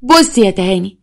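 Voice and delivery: ordinary delivery, nothing marked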